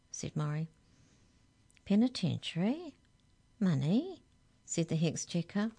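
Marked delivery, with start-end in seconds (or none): 1.9-4.2 PENITENTIARY and MONEY each rising sharply at the end; questioning echo. A narrator's reading. Hex checker querying the giants, questioning PENITENTIARY, MONEY.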